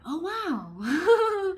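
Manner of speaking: high, loud comic revelation; hammed-up wonder; mock amazement. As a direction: blurt it as a stunned realization. Acts out sudden realization.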